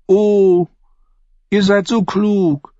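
high, slow pouty tease; pouting. Acted. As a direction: pout the words playfully. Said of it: "schmollte sie".

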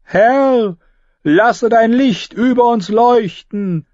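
high, slow ringing invocation; prayer called out. Acted. Priestess calling out prayer to her god, ringing high invocation. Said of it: "rief Melisandre".

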